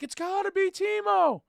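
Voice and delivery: high, loud insistent shout; yelling. Retold or imitated. Fan shouting at the bench for Timo, high and loud. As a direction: demand it at the top of your voice. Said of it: "I'm like".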